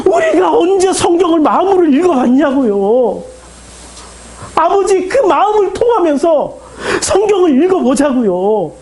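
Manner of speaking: high, impassioned cry to the congregation; anguished preacher's appeal; tearful pleading. Real, not acted. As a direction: plead through tears, pitch soaring. Preacher's emotional appeal after tearful scene.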